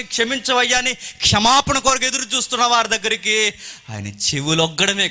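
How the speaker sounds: high, loud pulpit exposition; impassioned preacher's build; full-force preaching. Real, not acted.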